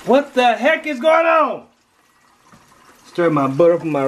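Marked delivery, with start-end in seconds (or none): 0.0-1.7 surprised shout. Real, not acted. Sudden exclaim at cooking.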